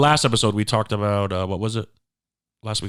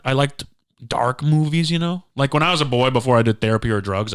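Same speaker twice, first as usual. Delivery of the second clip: usual speaking voice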